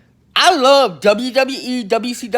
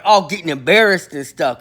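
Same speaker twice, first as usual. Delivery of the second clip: normal talking voice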